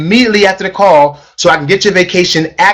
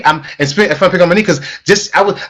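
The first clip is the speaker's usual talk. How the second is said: ordinary delivery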